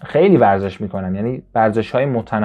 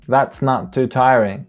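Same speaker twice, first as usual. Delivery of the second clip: very slow, drawn-out reading; slow demo; 2 semitones higher, much slower than usual. Shown as a demonstration. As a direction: stretch every word for learners. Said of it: "نه".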